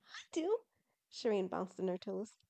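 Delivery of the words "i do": very high, eager pipe-up; eager announcement. Acted. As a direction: jump in brightly, high-pitched. Shireen bouncing, eager to explain.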